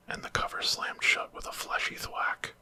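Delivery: ordinary delivery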